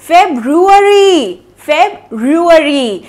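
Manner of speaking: फेब्रुअरी slowly, feb-roo-ary, twice; repeat-after-me drill. Shown as a demonstration. Teacher leading the class to repeat फेब्रुअरी. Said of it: "मेरे पीछे बोलिए".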